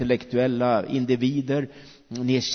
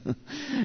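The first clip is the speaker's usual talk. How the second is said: short laugh; 13 semitones higher, 10 dB quieter than usual. Real, not acted. Laughs at praying in sleep.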